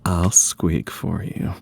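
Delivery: slow, coy come-on; slow sultry teasing. Retold or imitated. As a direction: drawl it out flirtatiously. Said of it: "Adrian's like".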